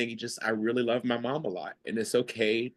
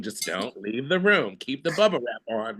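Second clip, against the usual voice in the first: ordinary talk, nothing marked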